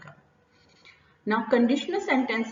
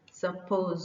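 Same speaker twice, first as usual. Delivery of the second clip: hesitant fall-rise; fall-rise on suppose; 2 semitones lower, 3 dB quieter, much slower than usual. Shown as a demonstration. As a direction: dip then lift within the word "suppose". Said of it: "सब पर आप, अ, low pitch रख रहे हैं".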